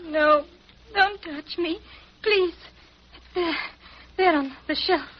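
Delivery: faint, frightened pleading, half-conscious; begging in a feverish daze; delirious fear. Acted. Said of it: "she's semi-conscious".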